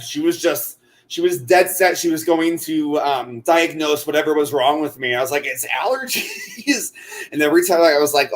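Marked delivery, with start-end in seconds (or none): 5.5-6.9 high, soft, drawn-out mock whine; laughing imitation of a weary protest; mock exasperated protest. Retold protest to fussing old lady.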